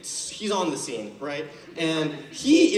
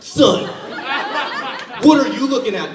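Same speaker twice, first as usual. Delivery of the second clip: louder, higher, barking cop challenge; 7 semitones higher, 7 dB louder than usual. Retold or imitated. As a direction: bark it like a cop who wants a fight.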